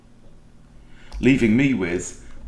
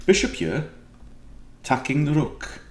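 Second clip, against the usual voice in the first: higher-pitched standard Welsh; lightly Welsh, pitched up; Welsh accent; 5 semitones higher than usual. Shown as a demonstration. Chess player going back to standard Welsh, lightly accented. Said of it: "standard Welsh".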